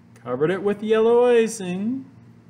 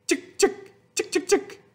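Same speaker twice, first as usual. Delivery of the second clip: very high, light chicks; high chick sounds; 14 semitones higher than usual. A narrator's reading. Reading to kids, high sprinkle sound. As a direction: tinkle each chick like sprinkled sugar.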